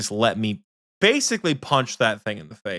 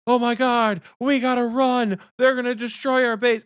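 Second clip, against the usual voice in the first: high, squeaky mock panic; panicked Michael; 11 semitones higher than usual. Mocking her teammate fleeing goblins, high panic. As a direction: ham up his fear of four goblins. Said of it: "And Michael said".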